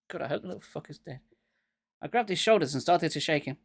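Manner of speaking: ordinary delivery, nothing marked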